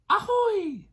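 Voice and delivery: very high squeaky Ahoy; high baby pirate. Shown as a demonstration. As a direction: pipe it up like a tiny pirate. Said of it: "Can you say ahoy?".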